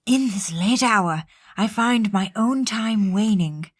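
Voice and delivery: very slow, solemn character voice; Sean Connery. Acted. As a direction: read it gravely with long drawn-out words, imitating an actor. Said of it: "Just do the fucking Sean Connery one".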